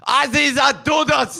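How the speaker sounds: put-on shouted, high German accent; movie villain German accent. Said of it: "making the".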